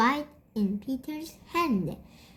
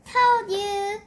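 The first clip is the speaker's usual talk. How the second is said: put-on higher sing-song little-boy taunt; Peter gloating; 9 semitones higher, 4 dB louder than usual. A narrator's reading. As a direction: rub it in, teasing. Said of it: "said Peter".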